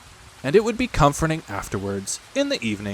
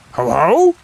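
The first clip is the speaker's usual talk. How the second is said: loud gloating monster ho ho, less sure; surprised Heffalump; 8 semitones higher, 10 dB louder than usual. A narrator's reading. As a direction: gloat but wobble. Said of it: "Heffalump, surprised".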